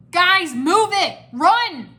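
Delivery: high, loud, stretched command; urgent shout. A narrator's reading. Cole shoves them toward the tunnels, urgent shout. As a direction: yell to get them moving. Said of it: "Cole shouted".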